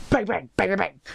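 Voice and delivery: high, soft little bang noises; voiced gunshots. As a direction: imitate gunfire in a small high voice.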